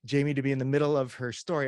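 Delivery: ordinary delivery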